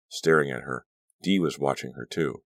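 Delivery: ordinary delivery, nothing marked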